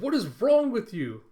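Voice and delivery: loud, high mock shout; exasperated outburst. Camilla to meddling coworker, exasperated shout. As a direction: imitate someone yelling in utter exasperation.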